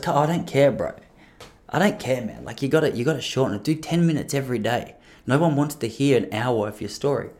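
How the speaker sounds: quiet, dismissive gangster impression. Comedian friend dismissing the podcaster's excuses, lightly voiced gangster. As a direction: lightly imitate a gangster waving off excuses, softly. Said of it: "a little more gangster than he is".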